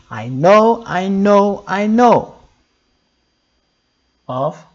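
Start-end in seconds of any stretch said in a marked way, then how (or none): 0.1-2.4 emphatic declaration. Shown as a demonstration. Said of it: "Being sure is what?".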